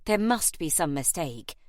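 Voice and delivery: ordinary delivery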